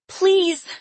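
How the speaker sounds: high, loud pleading cry. A narrator's reading. Lily calls after departing captor, crying out. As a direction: beg as a desperate little girl. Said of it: "Lily cried".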